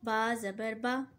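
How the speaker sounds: ba zabar ba, each sound slow and clear; teacher sounds out बा ज़बर बा syllable by syllable; slow demo reading. Shown as a demonstration. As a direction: spell out बा ज़बर बा slowly. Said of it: "एक हरकत के साथ लफ्ज़ को कैसे पढ़ेंगे".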